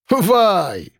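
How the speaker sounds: pleased Caucasian exclamation; drawn-out Caucasian vai. A narrator's reading. Caucasian roommate greets Sasha, drawn-out Caucasian vai. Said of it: "протянул он".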